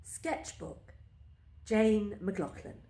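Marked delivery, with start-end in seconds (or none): none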